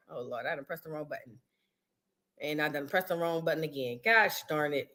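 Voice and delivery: low, hushed grumble to oneself; muttered aside. Real, not acted. Host pressed the wrong button on the stream, muttering low. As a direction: mutter it under your breath, low and soft.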